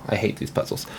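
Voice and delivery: sighing, whispered complaint; whispered grumble. Real, not acted. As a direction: grumble it under your breath. Sighs, frustrated with a puzzle.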